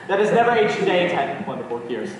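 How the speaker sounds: fast, run-together recital; rattled-off toast. Acted. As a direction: rush through the repeated toast. Toast echoed back in a hurry.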